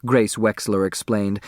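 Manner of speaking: ordinary delivery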